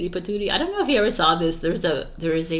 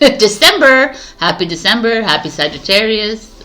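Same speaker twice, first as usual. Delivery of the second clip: louder and higher joyful greeting; excited cheer; 4 semitones higher, 9 dB louder than usual. Acted. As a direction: burst in celebrating. Excited opener after laughing.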